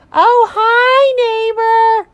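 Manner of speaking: very high, loud cartoon rabbit; piercingly high, hammed-up hello; grating bunny neighbor. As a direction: greet in an over-the-top squealing bunny voice. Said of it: "to that voice every day".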